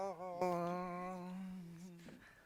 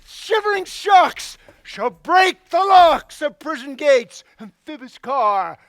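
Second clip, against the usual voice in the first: bellowing, slow Hercules rant; lofty ranting tyrant; 4 semitones higher, 7 dB louder, slower than usual. Acted. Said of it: "This was lofty".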